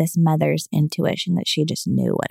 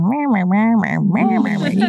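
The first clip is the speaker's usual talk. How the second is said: mock muffled meh-meh, higher and sing-song; Charlie Brown adult meh; 3 semitones higher than usual. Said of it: "it was like Charlie Brown".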